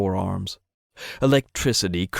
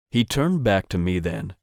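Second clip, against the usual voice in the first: usual speaking voice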